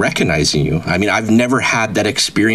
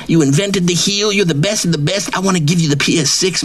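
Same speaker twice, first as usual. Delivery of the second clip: pitched-up, swaggering braggart voice; cocky wrestler persona; 9 semitones higher than usual. Acted. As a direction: brag like a washed-up wrestling star who thinks he's famous. Persona brags about hookups.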